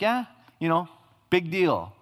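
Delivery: dismissive shrug. Retold or imitated. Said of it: "we might think".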